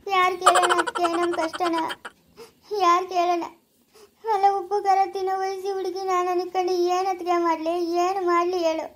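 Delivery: crying, words stretched out; tearful lament. Acted. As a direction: lament helplessly through tears. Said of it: "ಅಳ್ಬೇಡ ಸುಮ್ನಿರು".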